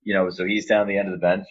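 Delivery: normal talking voice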